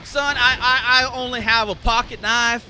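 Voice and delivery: put-on Southern US accent, lifted and loud. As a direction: play the Southern caller on the phone. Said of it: "if I can even do a southern voice".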